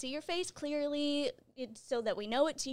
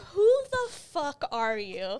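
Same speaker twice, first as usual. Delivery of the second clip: slow, louder mock-appalled coworker; shocked coworkers; 6 dB louder, slower than usual. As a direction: drag it out in disbelief. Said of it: "My coworkers are like".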